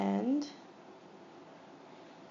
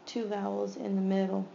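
usual speaking voice

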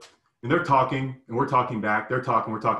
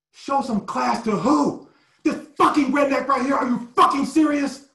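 high, loud, enraged shouting back; furious yell; 12 semitones higher, 6 dB louder than usual. Retold or imitated. Player losing it at his coach, high loud furious yell. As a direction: explode at the coach, drawn out at first. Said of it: "And I just lost it".

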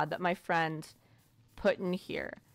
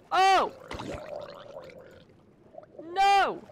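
high, loud startled cries; alarmed yelp; 11 semitones higher, 6 dB louder than usual. Real, not acted. Player in trouble needing oxygen, alarmed yelp. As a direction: yelp in sudden alarm, far above your usual pitch. Startled during underwater game play.

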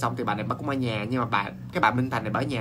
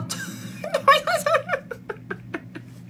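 high laugh. Real, not acted. Laughs at the pig's bad luck.

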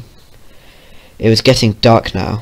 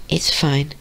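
high, soft, tearful little voice; quiet high-pitched reassurance after crying; sniffling; 5 semitones higher, 6 dB quieter than usual. Acted. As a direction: say it small and high, still a little upset. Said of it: "she sniffed".

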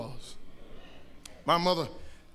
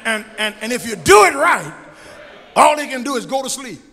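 preaching build; 5 semitones higher than usual. Acted. Pastor telling the church about discipline, preaching. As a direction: build the point to the crowd.

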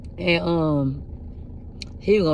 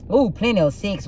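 ordinary talk, nothing marked

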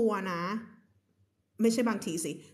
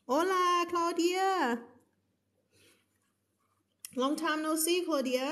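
high bright mock Spanish hello; playful Spanish greeting; 7 semitones higher, much slower than usual. Teacher jokingly greeting a viewer in Spanish. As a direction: greet like a cheery Spanish speaker. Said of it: "ฉันพูดสเปนิชไม่เป็นนะ".

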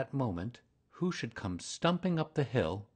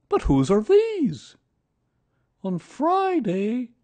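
Pooh reciting poem; 13 semitones higher, 9 dB louder than usual. A narrator's reading. Pooh recites his little poem to Kanga, sing-song and higher. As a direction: recite a silly poem with earnest care.

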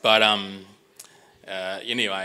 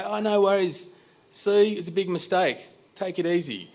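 high, sing-song pushover voice; mock easygoing; 11 semitones higher than usual. Customer imagining what Sue wants him to say, mock agreeable. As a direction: imitate a meek customer, pitched way up. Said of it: "She wants me to go".

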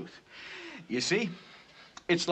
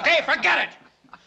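angry outburst; 12 semitones higher, 6 dB louder, slower than usual. Acted. Stung by laughter, angry.

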